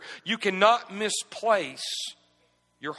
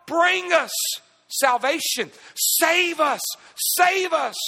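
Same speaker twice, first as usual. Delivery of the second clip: loud, high pleading cry; preaching at full force; 8 semitones higher, 4 dB louder, much slower than usual. Acted. Pastor crying out the crowd's plea, full force. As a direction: cry out for salvation.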